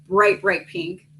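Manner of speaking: normal talking voice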